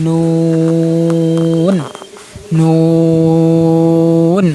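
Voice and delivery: নুন with a very long, level oo; chanted, sustained নুন ending in a nasal hum; tongue-to-palate N. Shown as a demonstration. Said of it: "ওই তালুর সাথে লাগিয়ে উচ্চারণ করবেন".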